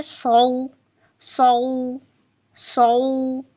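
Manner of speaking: सौ with a thick saad; heavy saad. Shown as a demonstration. Teacher repeating the heavy saad. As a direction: say सौ heavy and full. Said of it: "सौद मोटा होगा".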